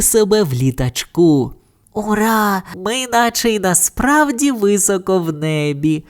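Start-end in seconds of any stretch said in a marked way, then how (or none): 1.9-6.0 high, delighted character voice; higher-pitched cheer without extra volume; excited exclamation. A narrator's reading. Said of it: "вигукнула Бао".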